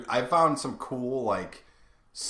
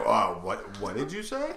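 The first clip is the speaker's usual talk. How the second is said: mock disbelief. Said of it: "I was like".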